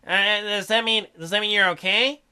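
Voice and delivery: ordinary delivery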